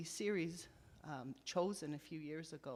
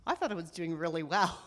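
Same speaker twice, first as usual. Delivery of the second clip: tearful; 7 dB louder, faster than usual. Retold or imitated. Retelling crying with her husband.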